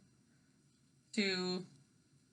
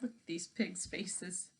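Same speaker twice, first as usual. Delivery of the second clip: soft aside; 3 semitones lower, 10 dB quieter than usual. Real, not acted. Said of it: "very soft aside".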